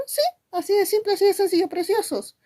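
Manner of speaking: very high-pitched, quick reply; playful reassurance. Acted. Teacher cheerily answers imagined pupil. (respuesta juguetona en voz muy aguda)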